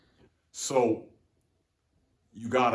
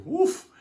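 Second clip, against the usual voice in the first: whoop; 7 dB louder than usual. Real, not acted. Reacts to own joke.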